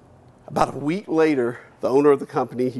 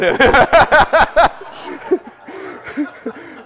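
big laugh; 11 semitones higher, 7 dB louder than usual. Real, not acted. Laughing at his own joke.

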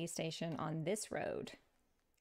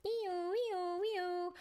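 high wee-woo siren noise; sing-out mock siren wail; imitated siren; 11 semitones higher, much slower than usual. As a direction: mimic a police siren, high and sustained. Imitates a siren for flashing lights.

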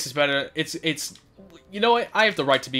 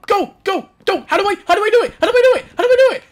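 high, loud rapid-fire panic; frantic urging; 9 dB louder, much faster than usual. Real, not acted. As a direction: blurt it out loud and high, over and over. Frantic panic mid game.

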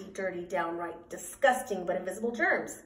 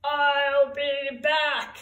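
very slow, higher-pitched germ voice; slow, drawn-out cartoon menace; villainous germ; 5 semitones higher, 3 dB louder, much slower than usual. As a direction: draw out each word like a villain's parting threat. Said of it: "the germ that makes you throw up said".

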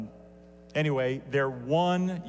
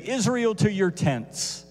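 calling out; 7 semitones higher than usual. Retold or imitated. Voices say.

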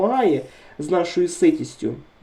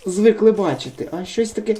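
ordinary delivery

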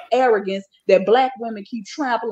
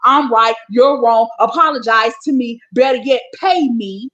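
loud list of demands in punched phrases; imitating a self-righteous star, much louder; Monique; 7 dB louder than usual. Critic voices the comedian's demands, loud and insistent mimicry. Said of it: "She just wants to be this".

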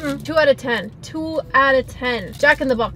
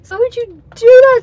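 much louder and higher, near a shriek; outraged yell; 9 semitones higher, 12 dB louder than usual. Real, not acted. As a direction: yell it at the food in real disbelief. Repeated, far more outraged.

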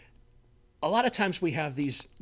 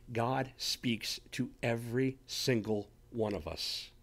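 earnest assurance; 4 semitones lower, 7 dB quieter, slower than usual. Real, not acted. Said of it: "preacher's earnest emphasis".

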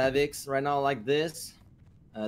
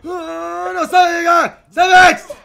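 frantic high shouting; panicked yelling; 13 semitones higher, 19 dB louder, much slower than usual. Real, not acted. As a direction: scream for help in real panic. Teammate in danger, he's blinded.

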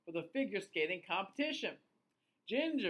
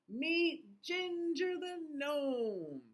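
storybook narration; 10 semitones higher, slower than usual. A narrator's reading.

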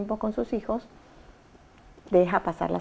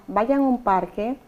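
normal talking voice

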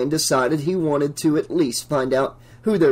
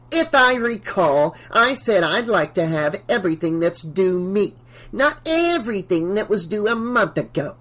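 higher, haughty landlady voice; imperious Missus Deagle; 5 semitones higher than usual. A narrator's reading. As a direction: correct the tenant with haughty precision.

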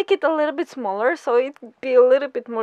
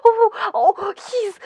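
normal talking voice

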